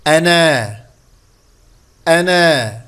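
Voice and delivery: normal talking voice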